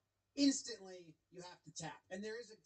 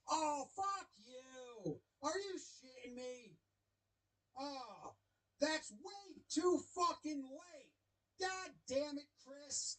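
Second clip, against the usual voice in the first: higher, slow drawn-out mock rage; mock furious outburst; 5 semitones higher, slower than usual. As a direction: ham up the manager's fury. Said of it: "his immediate reaction is".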